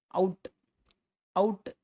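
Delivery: "அவுட்" starting on an open "a"; ஆ sound demo. Shown as a demonstration. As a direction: model "அவுட்" twice as "aut", opening on "aa". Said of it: "இது வந்து ஆ". ("அவுட்" ஆ ஒலியில் தொடங்கி உச்சரி)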